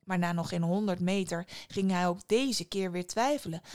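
ordinary delivery, nothing marked

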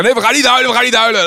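high, loud playful jollying; playful teasing. Retold or imitated. Preacher jollying a crying woman along, high and loud. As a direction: joke her out of tears, bouncy. Joking to stop her crying.

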